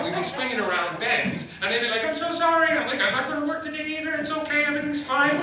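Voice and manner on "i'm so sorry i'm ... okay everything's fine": much higher, faster, laughing through it; mock cheerful reply. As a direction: chirp it like nothing's wrong. Passengers swinging on train, apologising.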